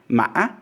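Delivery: "Ma" broken by a glottal stop, then rising sharply; hiccup tone. Shown as a demonstration. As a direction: catch the breath inside "Ma", then jump up. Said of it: "then it rises sharply".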